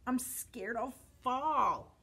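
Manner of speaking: slow, frightened storybook wail; acted sobbing fear. A narrator's reading. Trapped Tyro tells Tiny he's scared, slow fearful wail. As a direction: draw it out like a scared little dinosaur. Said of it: "Tyro sobbed".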